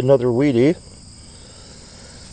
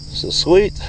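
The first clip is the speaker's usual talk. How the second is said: breathless treasure hunter; 8 semitones higher than usual. Real, not acted. Delighted at finding silver coin.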